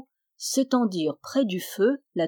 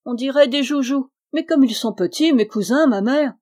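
higher and louder delight; delighted exclaiming; 5 semitones higher, 6 dB louder than usual. Acted. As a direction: marvel at tiny toys. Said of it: "s'écria-t-il".

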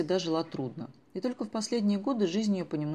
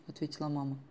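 ordinary delivery

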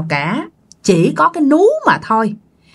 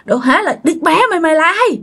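higher, angry shouting at the ghost; retold angry cursing; 8 semitones higher than usual. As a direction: hurl abuse at the intruder. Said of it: "Ba chửi nha".

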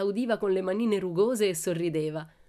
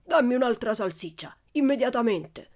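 loud, high, angry demand; bully's shouted order; shouting; 5 semitones higher, 5 dB louder than usual. A narrator's reading. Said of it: "lo scrollò gridando". (gridando con prepotenza)

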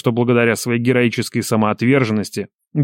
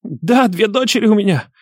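high, panicky bleating answer; pleading coward's high gabble; hurried, breathless babble; 11 semitones higher than usual. A narrator's reading. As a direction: blurt it out in fearful haste. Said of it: "торопливо задыхаясь, забубнила мразь".

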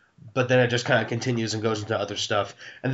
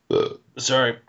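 burp slipping out mid-sentence; apologising right after a burp. Real, not acted. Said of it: "I burped again".

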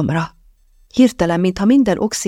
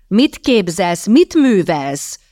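indignant, drawn-out thunder; 3 semitones higher, 3 dB louder, much slower than usual. A narrator's reading. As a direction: thunder it out, higher on the second question. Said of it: "dörögte".

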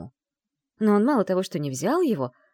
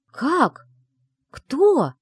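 startled, high and loud outbursts; taken aback; 10 semitones higher, 8 dB louder than usual. A narrator's reading. Secretary stunned by the aristocrat's bad news, taken aback. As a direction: blurt each word out in shock. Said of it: "опешила я".

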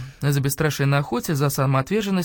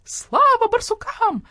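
very high, slow drawn-out cry; shrieked cheer; 3 dB louder, slower than usual. A narrator's reading. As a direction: squawk it out like a flock of hens. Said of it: "завопили куры".

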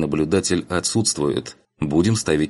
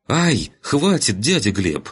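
high yelp into drawn-out plea; yelp, then beg him to stop; pleading girl; 6 semitones higher, slower than usual. Acted. (девичья мольба с ойканьем)

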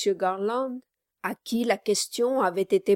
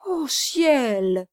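mock-pious cry, raised a little; shocked exclamation; 4 semitones higher, 5 dB louder than usual. A narrator's reading. Brass reacts to Kit's claim, shocked exclamation. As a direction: exclaim in dismay. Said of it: "s'écria Brass".

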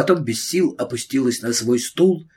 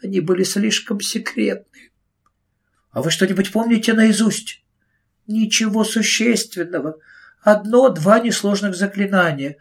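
distressed teacher; 7 semitones higher than usual. Acted. Miss Price voiced.

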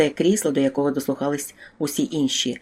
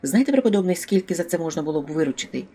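usual speaking voice

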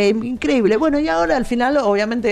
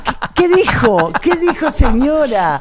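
laughing disbelief; 6 semitones higher, 4 dB louder than usual. Retold or imitated. Mocking reaction to posh lady.

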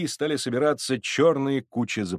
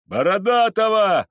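high, loud cry; forceful, raised call; crowd shout; 10 semitones higher, 7 dB louder than usual. Acted. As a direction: yell the rival's name.

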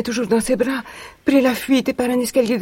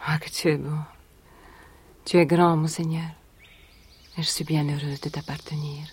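soft, lower whisper of devotion; adoring whisper; 7 semitones lower, 7 dB quieter than usual. Acted. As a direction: murmur it to him alone, lovingly. She adores her master.

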